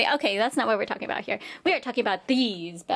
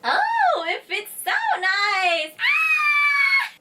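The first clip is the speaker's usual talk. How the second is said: high delighted squeal; excited shriek; 4 dB louder, much slower than usual. Real, not acted. Trying on new shoe, high delighted squeal.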